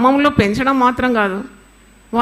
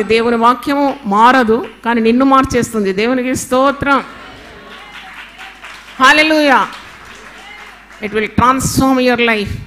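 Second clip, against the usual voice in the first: loud, driving sermon voice; hammering each line home, louder and louder, sermon at full force; full-force preaching; 4 dB louder than usual. Acted. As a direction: preach it hard to the congregation.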